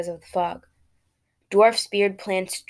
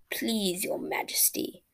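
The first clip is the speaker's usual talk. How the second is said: hushed slimy whisper like a snake; slimy nasal voice; 7 dB quieter, slower than usual. Acted. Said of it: "in a slimy, nasally voice".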